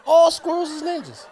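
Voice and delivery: All squirrels is ninjas shouted high and insistent; loud insisting. Shown as a demonstration. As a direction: insist it loudly with a laugh. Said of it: "repeats it louder".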